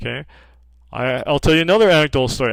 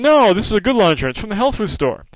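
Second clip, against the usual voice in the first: higher, fast, stubborn protest; insistent patient; 7 semitones higher, faster than usual. Retold or imitated. Patient on the phone defending her detergent, higher and fast. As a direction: brush him off, quick and defiant.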